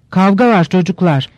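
ordinary delivery

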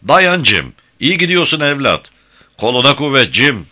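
high, loud shouts of encouragement; cheering on. A narrator's reading. Onlookers on the shore cheering Jim on, loudly. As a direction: cheer like spectators urging someone on. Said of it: "Onu desteklemeye başladılar".

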